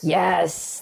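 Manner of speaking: ordinary talk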